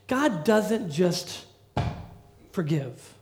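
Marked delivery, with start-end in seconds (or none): none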